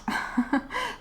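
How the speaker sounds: short high laugh. Real, not acted. Laughs at own lisp.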